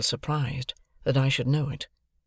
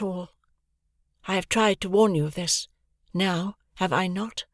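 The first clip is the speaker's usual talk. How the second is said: calm Estella; 8 semitones higher than usual. A narrator's reading. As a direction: speak with detached calm, unmoved. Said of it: "said Estella very calmly".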